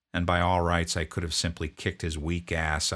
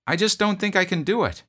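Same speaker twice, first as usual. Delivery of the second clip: put-on much higher, strained young voice; pleading private; 13 semitones higher, 7 dB louder than usual. Homesick private begging his drill sergeant, high plea. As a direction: plead like a scared recruit.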